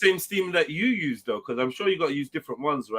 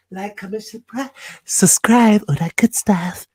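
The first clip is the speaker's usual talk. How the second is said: slow, stretched mock-silky plug, growing louder; imitated silky voice; 3 semitones higher, 7 dB louder than usual. Host mimicking the guest's plug, exaggerated silky voice. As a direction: mimic someone's silky sign-off, drawn out. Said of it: "ain't as silky as the other voice".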